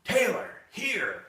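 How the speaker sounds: high, drawn-out call to a dog; hollering. Retold or imitated. Trainer re-enacts calling the dog back, high-pitched hollering. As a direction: holler the command across a field. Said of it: "And I'm hollering".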